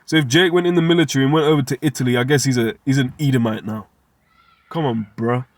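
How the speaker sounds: usual speaking voice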